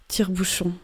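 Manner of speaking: ordinary delivery, nothing marked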